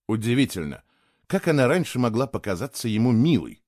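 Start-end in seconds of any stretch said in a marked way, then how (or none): none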